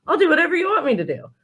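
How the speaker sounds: quick, high-pitched keen agreement; imitated eager employee. Voicing pleased employees she coached.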